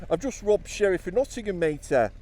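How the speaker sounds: high East Midlands bragging. Retold or imitated. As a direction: brag like a Nottingham lad.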